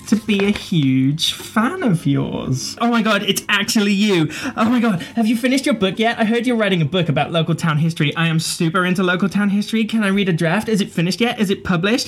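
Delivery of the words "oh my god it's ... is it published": high, fast, breathless fanboy; eager young fan. Acted. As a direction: gush like an excited young fan.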